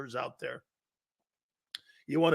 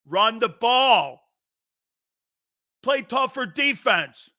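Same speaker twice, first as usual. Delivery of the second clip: fan yelling; 2 semitones higher, slower than usual. Acted.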